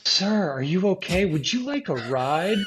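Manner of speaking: a lightly voiced concerned stranger; kind driver. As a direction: voice a worried passing driver. Stranger in car offers ride.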